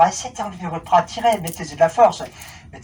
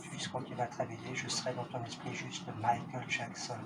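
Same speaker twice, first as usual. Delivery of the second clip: much softer, low hypnotic murmur; hushed suggestion; 4 semitones lower, 6 dB quieter than usual. Acted. Hypnotist murmuring a suggestion to the entranced volunteer. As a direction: slip the suggestion in quietly.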